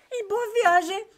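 high, exclaiming voice breaking into laughs; mock her outrage, laughing through it; indignant older lady. Older lady compares her daughter's delay, high and indignant.

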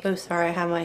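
normal talking voice

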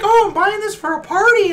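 a high, chirpy caricature of a chatty shopper; mock customer. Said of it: "She was like".

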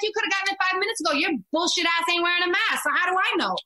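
high, very fast angry tirade; retold rant. Mom screaming at the maskless woman, fast and high. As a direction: spit it at her fast and high. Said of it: "Screaming at her".

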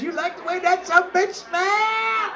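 put-on high voice for a nun; high-pitched mimicry. Mother Superior asking the Monsignor in kitchen, high put-on voice. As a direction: push it up high, away from your own voice.

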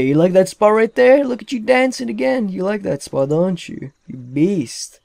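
high, teasing sing-song; playful high-pitched taunt; mock teasing. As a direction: tease the worker like a pet. Teasing a dancing worker.

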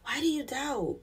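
same question dropped to a near hush; a quiet, searching echo of the rebuke; soft repeated rebuke. Retold or imitated. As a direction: ask it again gently, almost under the breath. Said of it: "He's like".

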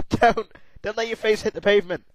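high, loud, fast urgent pleading; shouting warnings at a toppling ragdoll; frantic pleading. Real, not acted. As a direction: beg the character not to fall. Pleading with game character.

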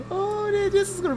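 high squealing excitement; thrilled squeal. Real, not acted. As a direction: shriek with delight at the near miss. Near miss while driving.

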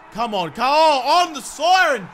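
loud, high celebration of a goal; excited cheer. Real, not acted. Gamer cheering his player's goal on the siren, excited cheer.

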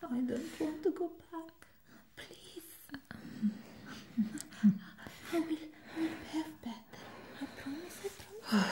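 high, hushed begging voice; desperate pleading. Acted. Patient begging not to be sent back, high and pleading.

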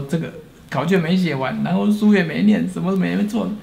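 a hint of sobbing through the excuse; child blurting out excuses between sobs; retold crying. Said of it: "稀里哗啦一直哭".

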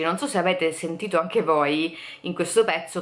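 ordinary talk, nothing marked

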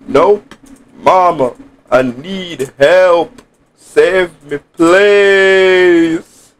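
high, drawn-out cry for mama's help; mock-helpless plea, stretching the final word long; panicked plea. Player begging mama to save him, high mock-panicked plea. Pleading for help in horror game.

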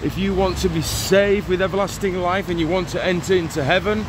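ordinary talk, nothing marked

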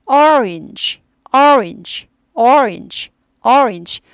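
teacher repeats orange; orange with an o-like vowel. Shown as a demonstration. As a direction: round the first vowel of orange toward o. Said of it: "어도 아닌 것이 약간 오에 가까운데".

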